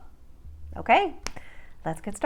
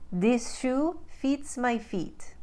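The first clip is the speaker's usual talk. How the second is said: slow, higher Ukrainian-accented demo; 5 semitones higher, slower than usual. Shown as a demonstration. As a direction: Ukrainian accent, "fits" said with a tight EE like "feet". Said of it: "But in the Ukraine, they take I".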